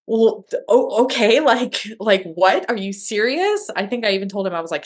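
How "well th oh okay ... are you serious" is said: raised, louder, half-laughing disbelief; can't quite believe the news; stunned disbelief. Retold or imitated. Retelling her reaction to her husband's call, stunned disbelief.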